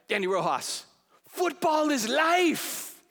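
Danny Rojas. Retold or imitated. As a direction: belt it out like a joyful footballer. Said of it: "John's like Danny Rojas".

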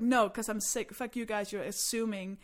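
loud, re-enacted rant at a crowd; mock-indignant stage tirade; yelling at audience. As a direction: challenge the audience, pitch climbing into the questions. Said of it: "I loved yelling at the audience".

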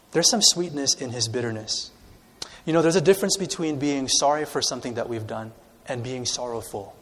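ordinary delivery

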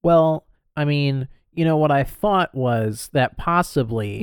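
slow, drawn-out hesitant hedging; waffling. Retold or imitated. Co-host imitating Charles's big reveal, slow waffling. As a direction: imitate someone unsure, stretching each phrase. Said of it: "Not so much waffling".

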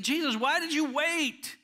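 high, searching plea; pleading question. Retold or imitated. The sisters in heaven asking Jesus, high plea. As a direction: ask him why he waited. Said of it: "I picture them in heaven talking".

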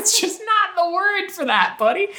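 high, slow, laughing scold. Real, not acted. Mocking the character, laughing and slow. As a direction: chide him through laughter.

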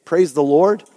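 usual speaking voice

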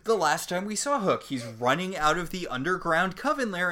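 high, loud indignant rant; viscerally angry. Real, not acted. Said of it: "has made me viscerally angry".

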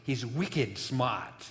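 slow Boston accent, r dropped in "smart" as "smaht". Shown as a demonstration. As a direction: drawl "wicked smart" the Boston way, no r. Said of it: "I can't get my Boston accent out".

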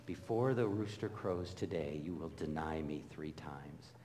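soft, near-whispered lament; quiet, grieving reading of the verse; hushed scripture reading. A narrator's reading. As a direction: let the sorrow sink to a hush. Reading Peter's denial gravely.

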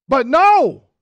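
loud, high preacher no; emphatic no. Real, not acted. Preacher answering his own question, loud and emphatic. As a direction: shout the answer out. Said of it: "preacher answering the question".

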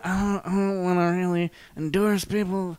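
high, softened put-on voice. Podcast host balking at endorsing people, in a put-on voice. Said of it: "I went into this voice".

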